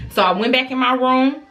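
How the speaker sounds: usual speaking voice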